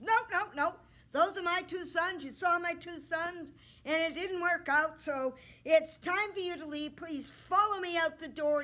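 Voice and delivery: a king showing the princess out; grumpy king. Acted. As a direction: hurry her out like a crabby old king. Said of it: "king with a terrible temper".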